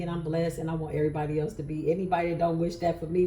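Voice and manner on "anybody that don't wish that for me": ordinary talk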